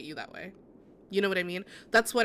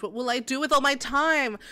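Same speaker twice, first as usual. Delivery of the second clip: ordinary talk, nothing marked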